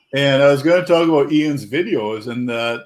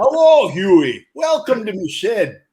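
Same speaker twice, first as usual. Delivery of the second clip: put-on Ian voice, greeting starts high; a light mock of Ian welcoming a guest; imitating Ian; 6 semitones higher, slower than usual. Greeting Huey as Ian at the shed, lightly mocking voice. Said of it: "Ian should never have said".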